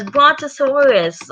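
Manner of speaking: put-on high-pitched toddler repeating a big word; two-year-old's echo. George repeats Daddy Pig's brontosaurus, high toddler voice. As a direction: parrot BRONTOSAURUS in a high little-kid pitch. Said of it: "George says".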